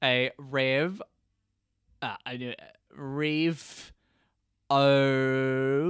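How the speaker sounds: "rev" and "O" as separate, halting pieces; syllable by syllable. Shown as a demonstration. Would-be revolutionary asked to repeat the word, giving it piece by piece. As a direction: break the word up slowly, pausing after "rev" and holding "O". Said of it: "You will start a what?".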